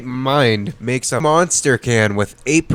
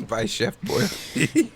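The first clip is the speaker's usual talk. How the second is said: high, slow ad-voice tag line, softer; mock announcer; 8 semitones higher, 6 dB quieter, slower than usual. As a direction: drag out Boyardee like a cheesy commercial. Mock announcing the brand name.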